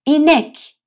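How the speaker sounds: اینک loud, with a spread ii; Turkish word inek. Shown as a demonstration. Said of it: "با دهان باز و کشیده". (اینک بلند و کشیده)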